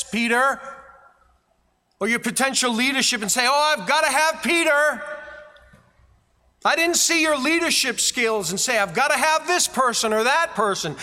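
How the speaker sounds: high, loud, driving preacher's voice; preaching at full force. Acted. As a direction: thunder it at the congregation like a revival preacher. Preacher voicing Jesus, then thundering.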